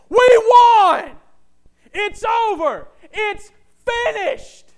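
huge high-pitched shout, words held long; full-force conqueror's victory yell; victorious battle cry. Acted. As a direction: bellow it from the mountaintop in triumph. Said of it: "the battle cries when the thing was over".

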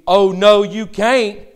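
loud, high flustered denial; heated rebuttal. Retold or imitated. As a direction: blurt out a loud, panicked no. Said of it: "would your rebuttal have to be".

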